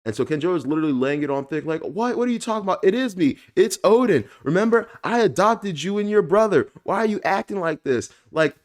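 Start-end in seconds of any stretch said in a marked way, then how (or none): 1.8-8.1 high, loud, overdramatic ham actor; mock-theatrical innocence, much higher; hammed-up overdramatic plea. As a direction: lay it on thick like a bad actor pleading. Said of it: "the lifelong ham actor".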